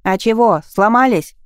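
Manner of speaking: put-on loud cheeky boy, shouting over an engine; brash kid yelling from a scooter; lively teenage boy.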